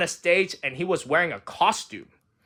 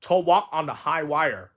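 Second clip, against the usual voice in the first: ordinary delivery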